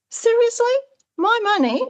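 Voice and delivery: can't believe what you're hearing; incredulous outrage. Retold or imitated. Speaker venting outrage at bank questions, high and disbelieving.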